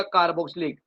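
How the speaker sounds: ordinary talk, nothing marked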